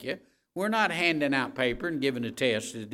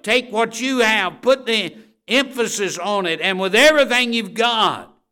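loud, higher-pitched exhortation, pushing hard; rally them to develop their gift; full-force preaching; 7 semitones higher, 9 dB louder than usual. Acted. Preacher urging listeners to develop their gift, full force.

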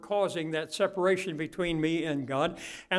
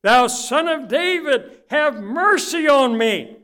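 slow, louder, higher plea; crying out; 9 semitones higher, 11 dB louder, slower than usual. Acted. Bartimaeus calling to Jesus, slow loud plea. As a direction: cry out to Jesus for mercy. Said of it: "he began to cry out".

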